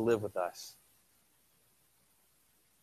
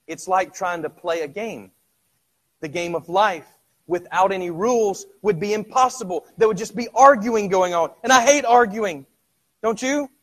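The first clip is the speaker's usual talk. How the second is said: high, loud, fast impassioned preaching; full-force preaching; 8 semitones higher, 10 dB louder than usual. Acted. Preacher presses the congregation on inner struggle, loud and fast. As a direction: drive it home to the congregation.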